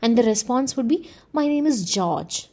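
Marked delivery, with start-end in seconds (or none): 1.3-2.4 George said as the answer, with the main stress on George. Shown as a demonstration. Teacher answering a question, stress on George. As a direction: land the weight on George. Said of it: "it is the word George that is re".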